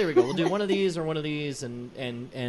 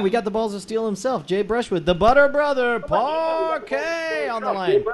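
raised, loud showman's introduction; hyped announcer intro; 7 semitones higher, 5 dB louder than usual. Pretend. Host brings caller Jay on the line, hyped announcer. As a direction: build to a high, stretched-out name.

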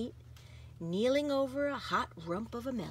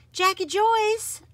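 calling out; 12 semitones higher, 9 dB louder, slower than usual. A narrator's reading. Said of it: "I breathe".

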